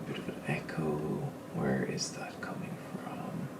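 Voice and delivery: muttered under his breath, whispering; whispered mutter. Real, not acted. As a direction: whisper it to yourself, puzzled. Muttering to himself about echo.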